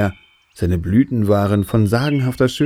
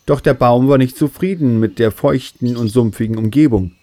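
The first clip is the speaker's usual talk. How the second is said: ordinary delivery, nothing marked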